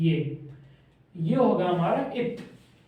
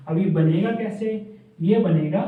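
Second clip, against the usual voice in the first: ordinary talk, nothing marked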